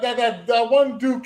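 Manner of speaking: normal talking voice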